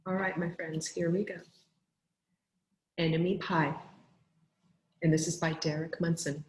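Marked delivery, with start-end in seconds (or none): none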